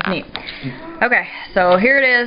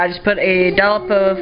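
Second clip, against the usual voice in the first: normal talking voice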